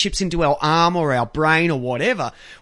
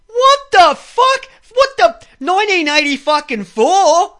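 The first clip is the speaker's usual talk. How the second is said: high, loud, stretched cry of disbelief; incredulous outburst; 11 semitones higher, 8 dB louder, slower than usual. Retold or imitated. Telling a friend about the car check, a loud incredulous shriek. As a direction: shriek it like you can't believe your ears. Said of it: "I'm like".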